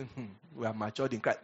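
low, soft, smug pious voice; mock pious calm.

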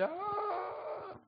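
long, high held "aah"; pained "ah". Retold or imitated. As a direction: wail a drawn-out high ah of realization. Retold cry on experiencing it.

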